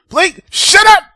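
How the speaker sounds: high, loud acted shout; exasperated Ruby. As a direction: yell it to cut someone off. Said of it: "And then Ruby was like".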